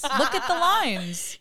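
high, loud, drawn-out "Look at the lines"; hammed-up mock outrage. Acting out armchair judging of the makeup, exaggerated outrage. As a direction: stretch LINES out in high, loud pretend horror. Repeats the critique, more exaggerated.

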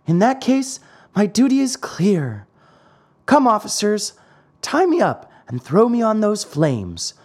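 high, brave puppet declaration; piercing little marionette voice. A narrator's reading. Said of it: "said in a piercing voice".

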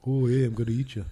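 put-on light jokey Canadian accent; mock Canadian accent. Co-host voicing the un-Canadian creature in a mock Canadian accent. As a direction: play a polite man-eater with a Canadian accent. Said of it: "We can do those accents".

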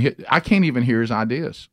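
normal talking voice

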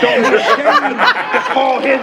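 shouting preacher. Retold or imitated. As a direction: call and response preaching. Call and response sermon.

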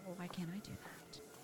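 whispering to herself; hushed whisper of self-doubt; whispered inner voice. Retold or imitated. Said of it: "and you're like".

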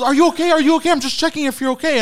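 high, loud anxious mother; worried mom voice. Retold or imitated. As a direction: fuss over him like a worried mom. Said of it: "And she goes".

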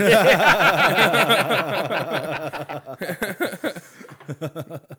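loud high laughing fit; big laugh. Real, not acted. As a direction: crack up hard. Laughing at own bit.

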